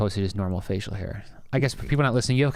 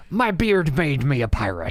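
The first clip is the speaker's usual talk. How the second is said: mock pirate boast; 5 semitones higher, 5 dB louder, slower than usual. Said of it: "And now you're like".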